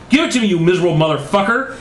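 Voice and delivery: fast, high-pitched outburst; hammed-up angry demand. A narrator's reading. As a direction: rattle off the insult in a high voice. Margaret angrily demanding the remote.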